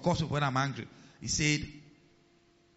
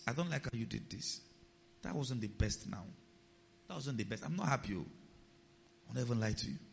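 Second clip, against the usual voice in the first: soft, restrained confrontation; calm and polite; 4 semitones lower, 8 dB quieter than usual. Acted. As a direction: state displeasure gently, keep it low. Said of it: "Do it calmly. Do it politely".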